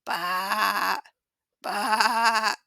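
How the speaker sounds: bleating sheep call, first one shouted; sheep baa. Shown as a demonstration. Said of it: "I think they go like this".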